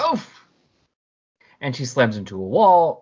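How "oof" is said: sharp high "Oof!" as she is hit; knocked breathless, oof; winded oof. Acted. Phase thrown through rock, a high winded grunt. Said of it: "she's like".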